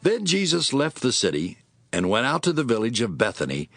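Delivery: normal talking voice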